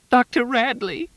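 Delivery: high wailing call; anguished cry. A narrator's reading. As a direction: cry out his name. Weeping woman calls out.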